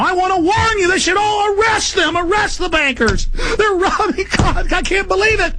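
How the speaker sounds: high strained outrage; high ranting outburst. Real, not acted. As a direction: cry out in outrage at the bankers. Ranting about bankers.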